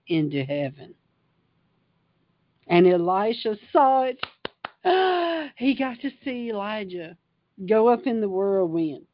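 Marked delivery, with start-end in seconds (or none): 4.2-5.5 a high, startled intake of breath; wonder-struck gasp; sharp awed gasp. Acted. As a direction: gasp as if seeing heaven open. Elisha sees Elijah taken up.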